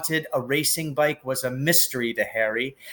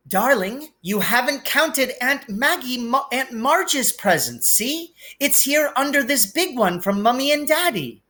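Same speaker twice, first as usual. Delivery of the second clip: much higher, fussing mother's voice; doting Aunt Petunia; 10 semitones higher than usual. A narrator's reading. Mother soothes her sulking son, high doting coo. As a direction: coo to calm the spoiled son.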